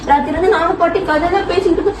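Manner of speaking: normal talking voice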